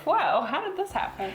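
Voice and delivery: high, amazed mock disbelief; a jump up in pitch to wide-eyed wonder; amazed whoa. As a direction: act out being stunned. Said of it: "at the time I was like".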